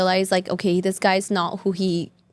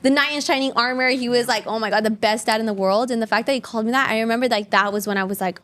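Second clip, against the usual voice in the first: ordinary delivery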